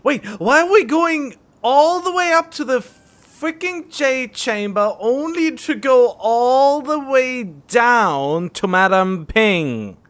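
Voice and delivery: loud, high wail of disbelief; exasperated outburst. Real, not acted. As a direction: complain loudly, dragging out the end. Exasperated complaint about a long detour.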